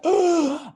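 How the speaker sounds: sharp, high intake of breath, reenacted; anxious gasp. Storyteller reliving walking into a noisy conference, anxious gasp. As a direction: act out a loud gasp of overwhelm. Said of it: "all my anxiety was up".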